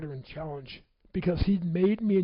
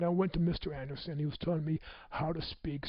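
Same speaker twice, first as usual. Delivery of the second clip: ordinary delivery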